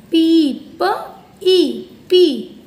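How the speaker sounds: पी stretched slowly and called out loud; blending the word pea. Shown as a demonstration. Teacher modelling पी for pupils, slow and loud. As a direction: draw out पी for the kids, then land it firmly. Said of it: "क्या पढ़ेंगे?".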